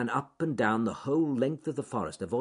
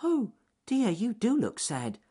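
very high, concerned rabbit voice; little bunny fussing over a friend; Ernest Fluffy Rabbit; 4 dB louder than usual. A narrator's reading. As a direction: pipe up with squeaky sympathy. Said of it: "said Ernest Fluffy Rabbit".

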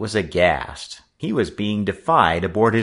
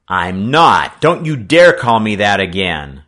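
indignant; 9 dB louder than usual. A narrator's reading. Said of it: "he was trembling, but not with fear".

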